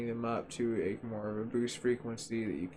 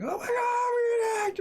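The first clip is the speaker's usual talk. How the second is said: much higher, louder, crying out in mock panic; mock panicked shriek; 5 dB louder than usual. Gamer acts out a viewer panicking over a hacked PC, shrieking. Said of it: "they're like".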